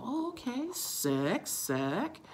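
high 'okay', rising drawn-out 'sick'; impressed approval. Retold or imitated. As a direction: think aloud, coolly impressed, swooping up. Her acted inner reaction.

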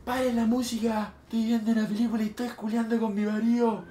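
high, stretched-out mock yell; retold neighbor's yell. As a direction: imitate the neighbor yelling, without real volume.

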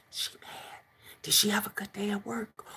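put-on whispered kids in the hallway; imitated whisper. Kids whispering in the hallway about angry mom. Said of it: "they be in the hallway talking, saying".

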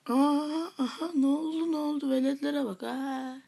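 loud, high-pitched gloating; mocking triumph. Real, not acted. Player jeering at the kids being beaten, gloating. Crowing over beaten rivals.

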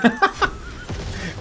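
short breathy burst of laughter; quick voiceless laugh. Real, not acted. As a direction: laugh it out, mostly air. Laughs during the game.

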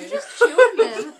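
loud laugh through words; cracking up, high and loud. Real, not acted. Laughing at friend.

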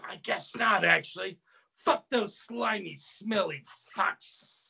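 disdainful serpent hiss; snake-faced demon. Acted. Snake demon answers, hissing.

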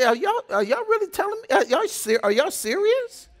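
high-pitched, stammering disbelief; incredulous. Retold or imitated. Preacher reacting to catty squabbles, incredulous. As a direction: squeal it out in exasperated disbelief.

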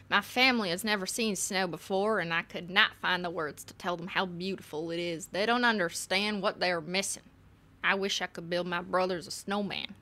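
put-on letter read in a Texan accent. Friend writing to Anne about snow, Texan accent. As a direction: read it out as a homesick Texan would.

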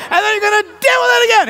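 high, fast, loud outburst; hammed-up high-pitched frustration; exasperated mock shout. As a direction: break in with a frantic yell. Acts frustration between sung refrains.

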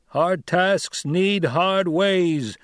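a tribesman calls it out defiantly; shouted from the crowd. A narrator's reading. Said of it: "someone shouted".

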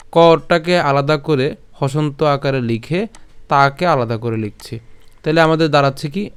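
ordinary talk

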